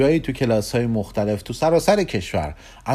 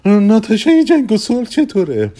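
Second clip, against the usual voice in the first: higher, louder offer; salesman's suggestion; 10 semitones higher, 7 dB louder than usual. Acted. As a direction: pitch it brightly as a fresh suggestion. Persky pitches another heroine.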